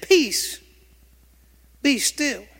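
rising commanding call; commanding the storm. Retold or imitated. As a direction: bid the storm be still with authority.

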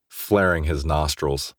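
ordinary delivery, nothing marked

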